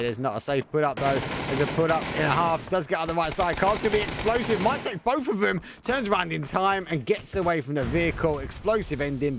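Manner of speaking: high, loud esports commentary burst; urgent, high-pitched live caster call; excited play-by-play. Real, not acted. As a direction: call the action live, voice soaring with the fight.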